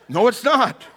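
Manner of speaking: loud, high mock-angry retort; hammed-up royal indignation; mad king. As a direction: push the protest up high and loud. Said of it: "he gets mad at the servant".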